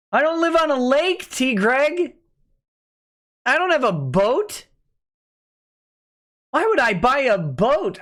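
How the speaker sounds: high-pitched exasperated disbelief; incredulous protest. Real, not acted. Streamer denying to T Greg that he owns a boat, incredulous.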